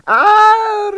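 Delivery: very high, loud gushing greeting; beaming Russian diva. A narrator's reading. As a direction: gush at the guest. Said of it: "she beamed at Quill".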